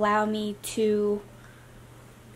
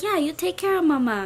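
put-on baby talk; 5 semitones higher than usual. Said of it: "and I'm like".

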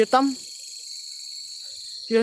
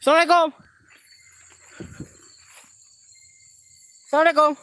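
high, loud hail; calling out; 10 semitones higher, 9 dB louder, much faster than usual. Real, not acted. Host hails whatever growled, calling out loudly. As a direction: shout the greeting into the dark. Hailing an unseen presence.

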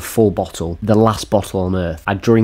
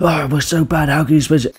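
high-pitched mimicry of a ranting football fan; mock aggressive rant; 7 semitones higher than usual. Mocking a streamer's Chelsea rant videos, mock ranting. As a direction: imitate a streamer's rant in a higher put-on voice. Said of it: "rant videos and aggressive, like".

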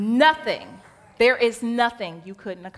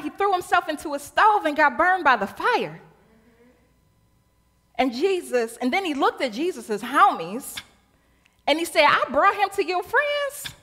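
lively retelling; 6 semitones higher, 4 dB louder than usual. Acted. Retelling gospel in modern voice.

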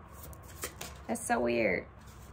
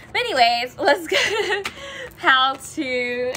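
laughing; 6 semitones higher, 6 dB louder, slower than usual. Real, not acted. Laughing at the card coincidence.

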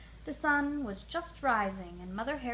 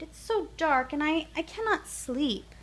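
put-on higher small-child voice, frightened; a scared little one who can't sleep; the little hare complains to his mother in the dark; little hare's plea; 6 semitones higher, 4 dB louder than usual. A narrator's reading. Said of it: "he cried".

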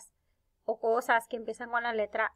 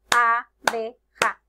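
abeja as a - be - ja, loud and slow; syllable by syllable; 7 dB louder, much slower than usual. Shown as a demonstration. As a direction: clap out abeja in three beats. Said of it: "Tiene tres sílabas".

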